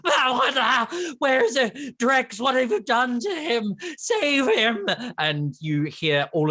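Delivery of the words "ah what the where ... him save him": high, yelping creature voices in a panic; acted high-pitched panicked yells; screaming kobolds. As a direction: yell like a horrified little kobold. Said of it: "screams and yells of all these kobolds".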